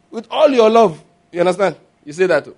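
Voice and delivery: ordinary talk, nothing marked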